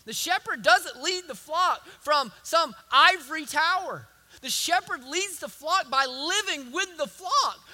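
ordinary talk

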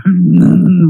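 loud nagging grumble; long disapproving cartoon-wife grunt; Marge Simpson. Friend showing Marge's annoyed noise, loud imitated grumble. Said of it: "that nagging or that".

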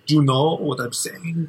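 high, put-on Spanish accent; Pepe accent. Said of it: "his accent".

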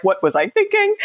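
high-pitched mock regret; retold panic. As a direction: reenact a sudden freak-out. Retold panic during labor.

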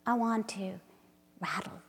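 slow, intimate poem recital with hushed drops; dramatic spoken-word reading; poetry reading. Acted. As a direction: read it like a love incantation. Reads her poem aloud.

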